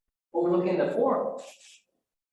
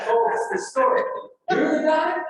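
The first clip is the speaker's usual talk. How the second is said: surprised recognition; 10 semitones higher, 6 dB louder than usual. Retold or imitated. Staff recognizing the storyteller.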